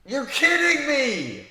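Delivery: slow, high, drawn-out disbelief. Real, not acted. Gamer seeing the Finals loss, slow stunned disbelief. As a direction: stretch it out in shock. Sim result reveals loss.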